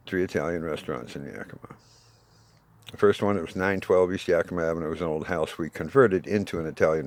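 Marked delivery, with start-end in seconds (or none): none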